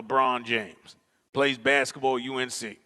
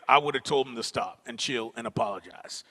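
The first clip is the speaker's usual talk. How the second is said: usual speaking voice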